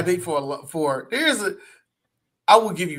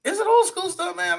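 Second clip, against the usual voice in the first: incredulous question; 12 semitones higher than usual. Real, not acted.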